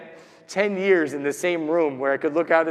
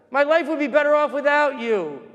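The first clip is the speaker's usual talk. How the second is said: high, raised-voice tirade; imitated angry shouting; 7 semitones higher, 4 dB louder than usual. Mother railing at the boy behind her, imitated shouting. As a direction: berate as the shouting woman would. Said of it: "and she's shouting".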